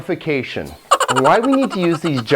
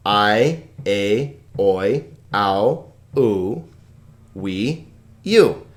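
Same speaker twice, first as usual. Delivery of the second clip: like a drill for the class to repeat; diphthong recitation; 3 semitones lower, much slower than usual. Shown as a demonstration. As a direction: separate sounds, a short pause after each. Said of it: "One more time".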